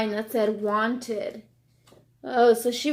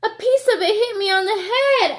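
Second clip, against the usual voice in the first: high, quick little hen voice; Chicken Little; 8 semitones higher, 6 dB louder, faster than usual. Acted. Chicken Little complaining to the officer, high and quick. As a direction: blurt it out like a flustered chick. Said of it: "added Chicken Little".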